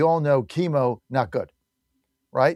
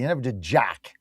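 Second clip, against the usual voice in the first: muttered jab; 4 semitones lower than usual. Real, not acted. Dismissive aside about senator.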